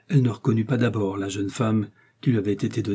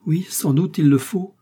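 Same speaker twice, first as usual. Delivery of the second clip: higher-pitched anxious cry; agitated exclamation; 6 semitones higher, 4 dB louder than usual. A narrator's reading. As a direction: exclaim it urgently, pitch raised. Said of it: "s'écria la reine". (exclamation agitée, voix plus aiguë)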